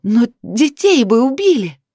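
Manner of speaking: high, slow angry shout. A narrator's reading. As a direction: cry out in protest. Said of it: "повышая тон, закричала Лиана".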